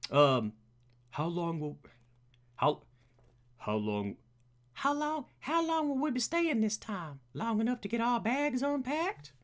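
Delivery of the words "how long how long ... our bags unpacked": very high put-on butler voice; high-pitched butler trial; Alfred. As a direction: push the butler up into a silly high register. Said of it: "we need a voice for Alfred".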